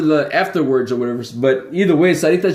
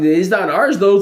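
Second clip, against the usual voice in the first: usual speaking voice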